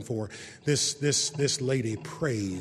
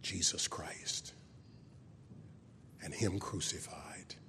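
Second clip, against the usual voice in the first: slow reverent whisper; 8 semitones lower, 14 dB quieter, slower than usual. Real, not acted. Preacher closing the sermon with a reverent whisper. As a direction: let it fall to a whisper.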